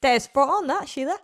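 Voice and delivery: put-on joking Aussie accent with Sheila; Australian accent. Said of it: "I'll do Australian if you want".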